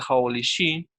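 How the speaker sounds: usual speaking voice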